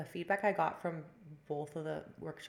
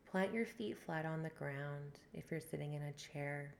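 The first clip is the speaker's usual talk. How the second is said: guided meditation. Acted. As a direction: ease them into the breath. Leads a breathwork meditation.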